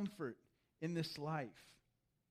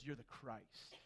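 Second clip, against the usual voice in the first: much softer, lower, almost breathed; hushed declaration; 7 semitones lower, 12 dB quieter, slower than usual. Retold or imitated. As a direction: lean in and say it low, in awe. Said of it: "And Peter goes".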